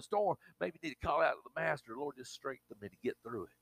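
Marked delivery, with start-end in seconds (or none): 2.0-3.5 hushed prayer. Acted. Voicing a prayer to the master.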